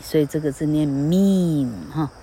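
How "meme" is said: meme with a long "ee" and silent final e. Shown as a demonstration. Teacher concluding the spelling rule, meme read with a long E. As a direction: draw out the "ee" in meme. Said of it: "你要念E".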